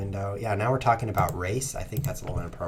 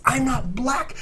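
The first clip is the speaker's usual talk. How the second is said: indignant outcry; 4 dB louder, slower than usual. Real, not acted. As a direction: protest in real disbelief, voice jumping up. Indignant at losing colour.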